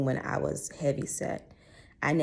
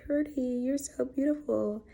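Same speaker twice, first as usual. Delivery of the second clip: put-on kids' voices; 12 semitones higher than usual. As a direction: voice the classmates sweet and high. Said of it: "they was like".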